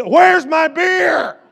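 high, slow, drawn-out shouted demand; mock yell of grandpa. Grandpa on crutches yelling for his beer, hammed-up shout. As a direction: bellow it like a cranky old man wanting his drink. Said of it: "I hear him screaming".

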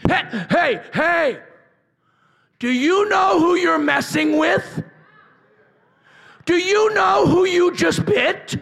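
loud, slow, high defiant shouting; triumphant challenge to a viper; Paul defying the snake. Acted. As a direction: stand up to the snake who bit you. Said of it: "it'd be like this".

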